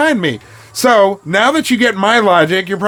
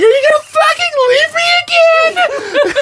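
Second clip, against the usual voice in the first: very high, wailing accusation; mock panicked shriek. Imitating partner as he gets dressed, mock panicked shriek.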